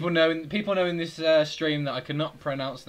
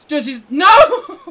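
long, high, loud cry of dismay; anguished shout; 11 semitones higher, 16 dB louder, much slower than usual. Real, not acted. Gamer watching his chance go wrong, anguished shout. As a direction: wail it out in real frustration.

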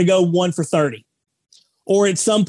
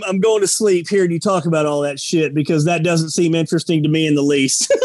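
normal talking voice

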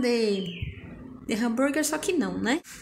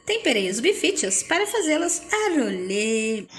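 normal talking voice

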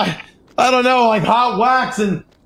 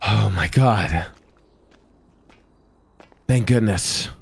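slow, much lower sigh of relief; relieved; 4 dB quieter than usual. Pretend. Captive just rescued by trooper, low relieved voice. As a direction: let the panic drain into low relief.

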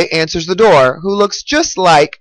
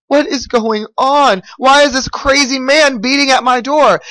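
put-on higher-pitched, bewildered little boy; alarmed boy; 6 semitones higher than usual. A narrator's reading. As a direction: lightly act a boy alarmed by the pounding. Frightened boy hiding from pounding mayor.

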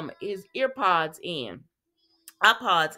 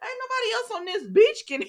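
incredulous; faster than usual. Real, not acted. Incredulous reaction, laughing.

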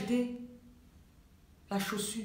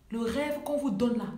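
normal talking voice

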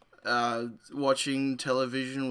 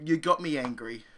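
ordinary talk, nothing marked

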